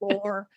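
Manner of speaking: Lore with a stretched long O. Shown as a demonstration. Fan imitating Jared drawing out the O in Lore. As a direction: draw out the O in Lore. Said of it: "a really long O sound".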